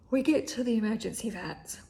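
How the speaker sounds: ordinary talk, nothing marked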